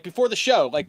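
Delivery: ordinary delivery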